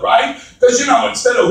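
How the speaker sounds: ordinary delivery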